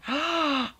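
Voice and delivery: high, quick gasp of surprise; delighted gasp. Real, not acted. Vlogger sees the highlight on her cheek, gasping happily. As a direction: gasp at a lovely sight.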